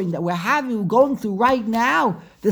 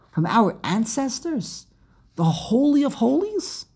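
usual speaking voice

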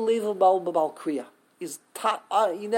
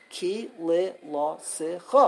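correct, syllable by syllable; 3 semitones lower, slower than usual. Shown as a demonstration. Teacher showing how it's supposed to be said, separate syllables. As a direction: calmly give every syllable its place. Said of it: "the way it's supposed to be said".

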